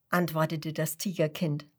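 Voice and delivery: plain reading voice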